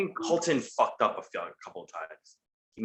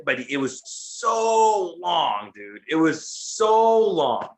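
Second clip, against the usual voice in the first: exasperated drawl; much slower than usual. Real, not acted. Cohost griping about an endless promo, slow drawn-out complaint. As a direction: stretch every word out slowly, exasperated.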